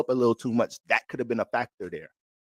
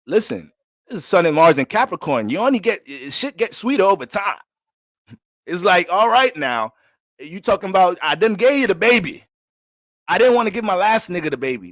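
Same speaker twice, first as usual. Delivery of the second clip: voicing Jeannie; 4 semitones higher, 7 dB louder than usual. Voicing Jeannie talking to [name].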